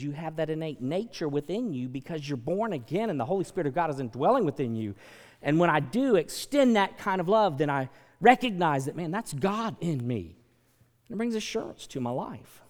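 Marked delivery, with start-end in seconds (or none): 5.4-10.4 much louder, driving each word; preaching loud. Real, not acted. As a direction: preach it out to the back row. Preaching climax.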